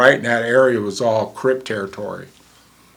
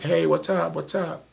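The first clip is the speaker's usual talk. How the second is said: wary greeting; 7 semitones higher than usual. Retold or imitated. Paramedic greeting strangers warily.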